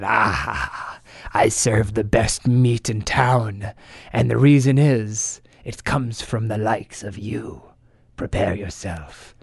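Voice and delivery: low, slow and sinister; menacing ogre voice. Acted. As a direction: growl it like a hungry ogre. Sinister shopkeeper in the pit.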